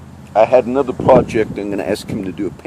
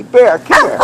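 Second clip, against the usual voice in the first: much higher, louder call for the dog; 13 semitones higher, 8 dB louder than usual. Real, not acted. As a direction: call out sharply for a dog that won't come. Calling the loose dog.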